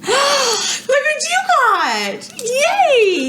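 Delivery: gasping, squealing delight; excited squeal. Real, not acted. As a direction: squeal with joy, laughing. Gasps at a friend's gift.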